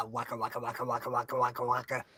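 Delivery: low, quiet chanted waka waka; Pac-Man sound imitation. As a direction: mimic the arcade game chomping. Heading to the Pac-Man machine.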